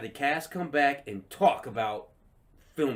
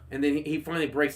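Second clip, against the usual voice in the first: ordinary delivery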